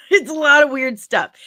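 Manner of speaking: high, loud laughing repeat. Real, not acted. Streamer summing up their odd conditions, laughing. Laughing at her own oddities.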